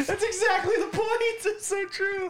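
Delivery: very high, squealing through laughter; helpless laughter stretching the words out; real laughing. Real, not acted. Agreeing with the coworker's customer story, laughing hard.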